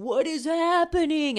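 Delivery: high, loud gasp of amazement; can't believe the good news; a mother hearing her son got a college offer, in amazed disbelief; stunned disbelief. Retold or imitated. Coach offers son a spot.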